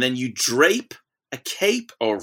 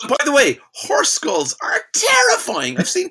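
emphatic horror; 13 semitones higher, 4 dB louder than usual. Real, not acted. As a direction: stretch it out high, aghast. Emphatic aside about frightening skulls.

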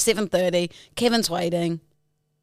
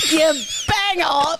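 mock shout; 5 semitones higher, 4 dB louder, slower than usual. Real, not acted. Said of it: "repeated louder".

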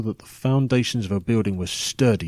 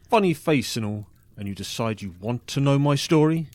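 ordinary talk, nothing marked

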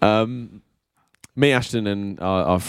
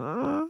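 soft aww; 9 dB quieter than usual. Retold or imitated. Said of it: "I saw Ellie and I was like".

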